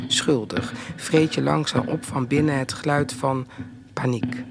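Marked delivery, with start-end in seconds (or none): 3.9-4.4 breathy whispered word; a whispered paniek. Acted. Poem names the sound of panic.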